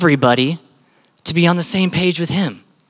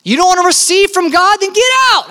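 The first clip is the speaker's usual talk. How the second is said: very high, forceful challenge; indignant cry, peaking on the last words; shouted ultimatum; 11 semitones higher, 3 dB louder than usual. Acted. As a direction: preach it as an angry eviction. Jesus ejecting doubters, acted.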